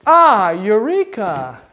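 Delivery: cry of discovery. Acted. Teacher hinting at the answer, hammed-up eureka moment. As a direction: draw it out like a sudden discovery. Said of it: "make me go".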